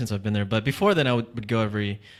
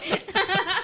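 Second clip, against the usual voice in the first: high laugh. Real, not acted. Teased about dating at ten.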